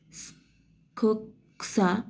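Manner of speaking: स्खक्सा drawn out slowly; slow careful. Shown as a demonstration. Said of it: "वचे जोड शब्द वाचत असताना".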